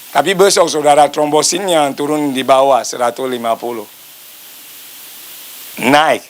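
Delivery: normal talking voice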